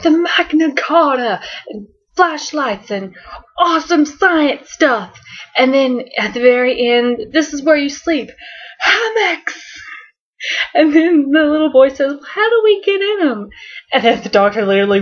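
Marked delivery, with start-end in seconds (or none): 0.0-5.2 high, excited Doctor rattling off toys; mock excited shout; going nuts. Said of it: "going nuts". 7.3-9.6 high delighted cry of hammocks; much higher, thrilled reveal; excited Doctor. Doctor showing kids the room. 12.3-13.5 put-on 1 little boy.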